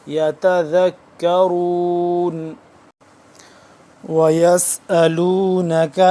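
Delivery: slow melodic Quranic chant; Quran recitation. Shown as a demonstration. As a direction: recite it like tilawat with long vowels. Recites verse. (قرآن کی تلاوت کا انداز)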